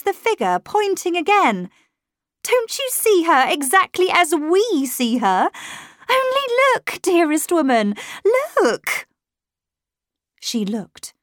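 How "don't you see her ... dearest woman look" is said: high, loud, desperate insistence; urgent pleading. A narrator's reading. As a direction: plead with them to look, voice raised. Shocked governess begs her to look.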